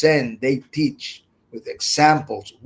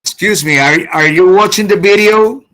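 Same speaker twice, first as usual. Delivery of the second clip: raised voice to get the class's attention; calling out; 5 semitones higher, 8 dB louder than usual. Real, not acted. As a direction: call out loudly to check they're listening. Teacher calls out to online students.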